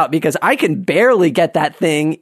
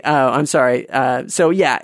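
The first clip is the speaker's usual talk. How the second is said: ordinary delivery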